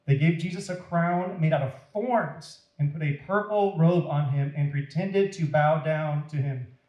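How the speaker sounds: ordinary delivery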